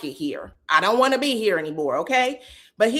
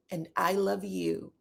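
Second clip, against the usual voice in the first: soft, lightly voiced baby voice; 8 dB quieter than usual. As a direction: say it softly like a smitten child. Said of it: "Like a, a baby voice".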